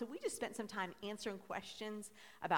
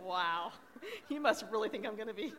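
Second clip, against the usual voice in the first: laughing; 4 semitones higher than usual. Real, not acted. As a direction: crack up mid-sentence, pitch rising. Laughs at being handed tissues.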